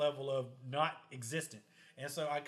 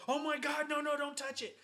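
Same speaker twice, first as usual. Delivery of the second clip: high mock-panicked cry, much higher; imitating panicked mom; 11 semitones higher than usual. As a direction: squeal it like a frightened mom. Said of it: "freaks the fuck out".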